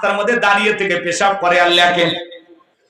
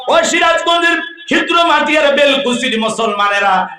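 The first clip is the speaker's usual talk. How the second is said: calling out; 7 semitones higher than usual. Acted. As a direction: rouse the whole audience. Calling out to the audience.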